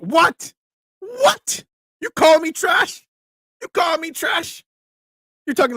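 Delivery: high-pitched, incredulous squawk; mock outrage. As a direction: play scandalized, voice leaping way up. Mock outrage at chat insult.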